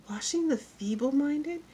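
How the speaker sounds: high, slow, rising query; puzzled schoolteacher echoing a pupil; incredulous teacher. A narrator's reading. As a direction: repeat it slowly in disbelief. Teacher queries pupil's odd words.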